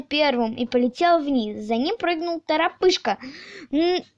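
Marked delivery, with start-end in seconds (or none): none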